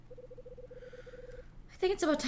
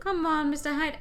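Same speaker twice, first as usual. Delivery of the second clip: put-on higher-pitched young woman; girl character voice; 6 semitones higher, 4 dB louder than usual. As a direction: play her friendly and light. Female character.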